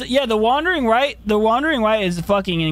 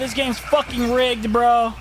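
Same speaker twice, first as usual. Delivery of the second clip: ordinary talk, nothing marked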